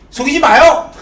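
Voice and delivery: high, loud mock complaint; acted loud protest. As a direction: protest loudly, half in fun. Acting out Iseon's protest. (높고 큰 소리로 항의하는 척)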